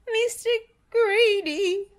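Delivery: very high, squeaky and scared; terrified squeak. A narrator's reading. Tiny Mister Greedy answering the giant in a frightened squeak. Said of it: "he squeaked".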